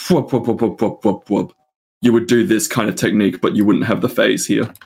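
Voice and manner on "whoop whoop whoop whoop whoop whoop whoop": whooping synth bass noises; bass wobble imitation. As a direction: mimic a wobbling neuro bass. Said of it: "neuro basses that go, like".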